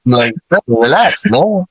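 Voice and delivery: high, drawn-out playful protest; mock exasperation. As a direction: jokingly tell a yeller to chill. Joking reply to being yelled at.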